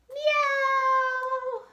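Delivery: loud, high yowling meow; cat meow. Acted. Cat crying at the window to be let in, yowling meow. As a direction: cry out like a desperate cat at the door. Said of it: "the meows soon changed into instant cries".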